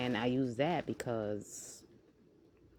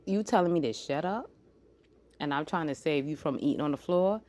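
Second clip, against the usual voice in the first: normal talking voice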